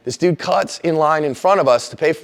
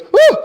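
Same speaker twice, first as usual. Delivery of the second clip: high loud whooping cry; wild woo; 9 dB louder than usual. Pretend. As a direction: let out a crazed whoop. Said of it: "Then he goes".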